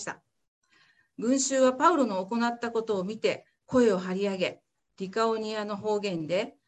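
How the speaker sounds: measured Bible reading for the congregation; formal church reading; scripture reading. Acted. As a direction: read the passage clearly and steadily. Reads Acts passage aloud.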